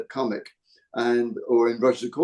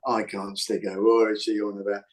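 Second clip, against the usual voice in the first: Sid; 3 semitones lower than usual. Retold or imitated. Imitating the dummy who hates his wife, low voice. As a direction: drop the pitch and grumble about the wife. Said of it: "and the low voice".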